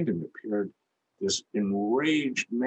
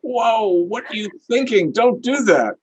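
much higher, louder mock alarm; alarmed inner voice; 8 dB louder than usual. The sensible inner voice stopping an outburst, high mock alarm. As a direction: cry out like a startled conscience. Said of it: "another part of us that says".

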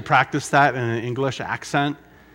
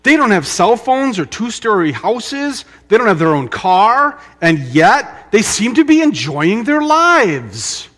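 mock amazement; 7 semitones higher, 7 dB louder than usual. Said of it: "Have you ever heard this?".

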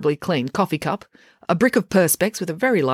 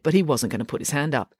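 ordinary delivery

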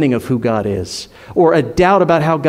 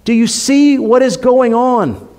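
raised, higher preacher's voice; God calling out; 6 semitones higher than usual. Acted. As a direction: challenge them urgently, as God calling. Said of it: "God is calling us to say".

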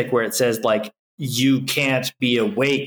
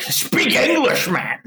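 mock exasperated; 9 semitones higher, slower than usual. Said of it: "And you're like".

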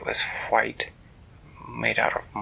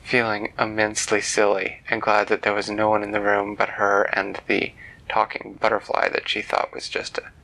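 ordinary delivery, nothing marked

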